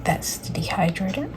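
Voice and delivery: soft unvoiced whisper. Real, not acted. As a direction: whisper it close to the mic. Whispered ASMR aside.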